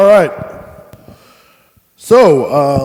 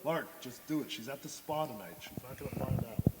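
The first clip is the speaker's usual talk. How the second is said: very soft, private urging; barely audible stage aside; hushed aside; 2 semitones higher, 19 dB quieter than usual. Acted. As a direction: coax a friend under your breath. Aside urging reluctant friend.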